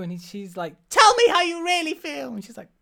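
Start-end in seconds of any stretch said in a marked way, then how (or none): 0.9-2.3 high, loud mock demand; acted insistent demand. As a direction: demand the truth, hammed up. Acted demand in retold scene.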